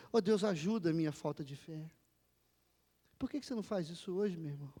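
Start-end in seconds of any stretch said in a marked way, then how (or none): none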